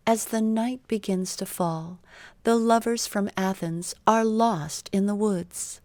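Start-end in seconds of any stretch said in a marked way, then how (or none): none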